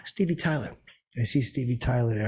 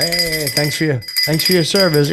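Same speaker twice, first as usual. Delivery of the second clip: mock salute; 4 semitones higher, 7 dB louder than usual. Drunk joking with security guy.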